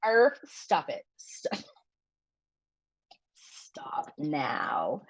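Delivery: whispered stop now; whispering. Real, not acted. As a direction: whisper it to herself. Joking whisper.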